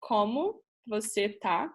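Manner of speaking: "Como você tá?" said slowly, Portuguese sounds; Portuguese greeting said slowly for the learners; Portuguese phrase demo. Shown as a demonstration. Teaching classmates to ask how are you, slow Portuguese. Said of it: "you can say".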